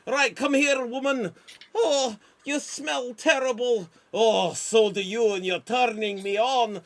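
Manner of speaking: loud, high, hammed-up Scottish bluster; Braveheart father. Braveheart father lording it over his son, hammed-up Scottish. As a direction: boom it like a hammy Scottish warrior dad. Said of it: "Here I go".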